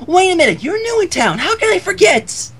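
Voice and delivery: high, excited realization; suddenly remember, thrilled; bubbly Pinkie Pie. Acted.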